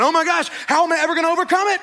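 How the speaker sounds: loud, high, fast panic; panicking. Retold or imitated. Believer panicking over money, loud and frantic. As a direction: blurt it in a frantic rush.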